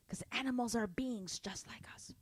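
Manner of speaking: whisper. Real, not acted. Soft whispered aside.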